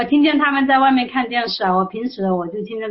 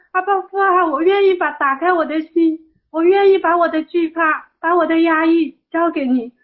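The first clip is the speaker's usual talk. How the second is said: high, pleading surrender; retold tearful prayer; 7 semitones higher than usual. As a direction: re-enact crying out in prayer, pitched high. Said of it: "一边喊一边哭". (转述哭喊祷告，高声恳求)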